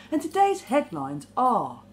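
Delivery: slow mock headline intro, falling at the end; newsreader. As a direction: announce it like a newsreader, drawn out. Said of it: "they always go down".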